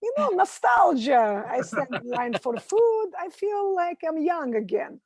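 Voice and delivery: put-on high-pitched mom with a Russian accent; Soviet mom accent. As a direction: imitate your Russian-accented mother protesting. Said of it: "my mom's from the Soviet Union".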